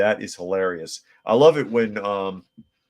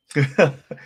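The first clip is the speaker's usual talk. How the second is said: a sudden high, loud laugh; burst of laughter; 7 semitones higher, 7 dB louder than usual. Real, not acted. Laughing out loud at the rivals' falling-out. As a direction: crack up at the irony.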